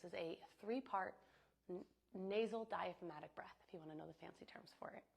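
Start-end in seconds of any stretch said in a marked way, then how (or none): none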